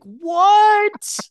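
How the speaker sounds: high, loud, incredulous what; disbelieving what. Real, not acted. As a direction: shriek it in disbelief. Reacting in disbelief.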